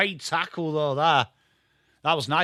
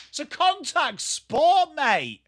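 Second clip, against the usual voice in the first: much higher and slow, pleading with the ref; exasperated protest; 10 semitones higher, slower than usual. Real, not acted. Streamer protesting the referee's call, exasperated high plea.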